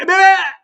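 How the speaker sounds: loud, high cry of pain; shoulder's scream. Retold or imitated. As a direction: let the shoulder yell.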